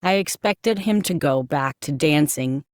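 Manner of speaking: usual speaking voice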